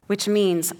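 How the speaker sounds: normal talking voice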